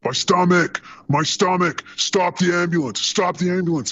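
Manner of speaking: high, mock yelling patient; mock scream. Medic imitating the patient screaming in the ambulance, mock yell. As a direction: imitate a patient screaming in pain, half-hammed.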